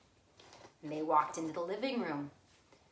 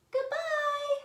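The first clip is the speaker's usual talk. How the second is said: much higher, louder little cub voice; squeaky Baby Bear; 7 dB louder than usual. Acted. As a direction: squeak it like an upset tiny bear.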